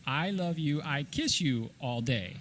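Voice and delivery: ordinary talk, nothing marked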